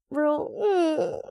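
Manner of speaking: a high, sultry little hum; sultry purr. Pretend. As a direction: purr it like a seductive tease. Sultry stripper-heel energy aside.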